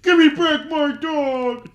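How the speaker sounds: high, loud, slow hammed-up scream; mock shriek. Reenacting the movie scream for his friend, high and loud. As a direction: belt it out like the girl in the pit. Quoting screaming line again.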